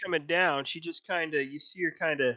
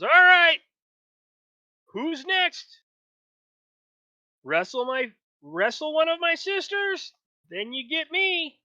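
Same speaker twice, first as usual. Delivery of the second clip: high, loud, brash orc challenger; female orc voice; 11 semitones higher, 6 dB louder than usual. Acted. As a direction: boom it out like a cocky orc wrestler. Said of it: "the female orc goes".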